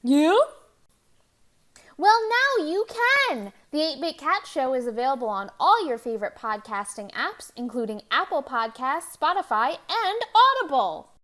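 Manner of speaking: loud, punchy promo announcer; booming podcast plug with swooping pitch; announcer ad read. Pretend. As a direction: sell it like a big, over-excited commercial.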